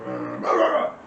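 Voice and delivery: loud barking dog imitation; imitating dog bark. The dog barks at brother grabbing a fry, loud barking. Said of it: "my dog goes".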